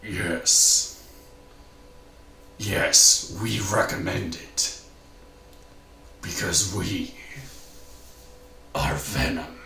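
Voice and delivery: deep, low symbiote voice; Venom. Acted. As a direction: growl it out as the monster. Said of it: "doing that voice and doing the other voice".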